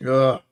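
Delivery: sudden loud hiccup; a sharp, involuntary hiccup burst. Real, not acted. Streamer caught by hiccups while getting set up, loud hiccup.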